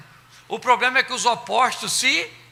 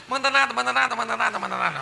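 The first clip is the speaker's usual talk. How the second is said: laughing mockery; 2 semitones higher, faster than usual. Real, not acted. Preacher mocking husbands, laughing. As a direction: laugh through the words.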